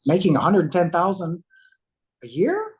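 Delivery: retold amazement, rising sharply at the end; incredulous question. Man checking a stranger's salary offer, incredulous.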